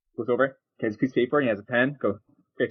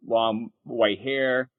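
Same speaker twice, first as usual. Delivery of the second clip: normal talking voice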